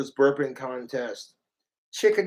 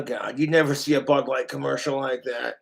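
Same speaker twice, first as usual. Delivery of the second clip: usual speaking voice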